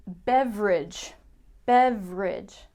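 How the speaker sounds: beverage said slowly as BEV-rij; two beats, bev-rij; two-syllable beverage. Shown as a demonstration. Teacher showing the short BEV-rij. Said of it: "Not beverage".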